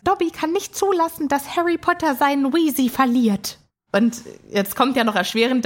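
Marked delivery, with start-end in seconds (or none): 0.0-3.6 high, earnest house-elf voice; Dobby. Retold or imitated. House-elf vows to help Harry, high and earnest. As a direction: declare it as a devoted little elf, pitched up. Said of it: "Dobby gesagt".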